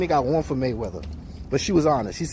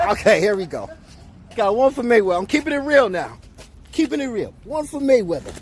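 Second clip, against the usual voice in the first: loud, higher, pumped-up score call; hyped announcement; 6 semitones higher, 5 dB louder than usual. Real, not acted. Street host hyping the Mayweather vote, loud and excited. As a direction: shout the tally like a hype man.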